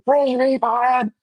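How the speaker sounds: slow hushed shout, drawn out; whisper shouting. Retold or imitated. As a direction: shout it under your breath, slowly. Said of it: "he's whisper shouting".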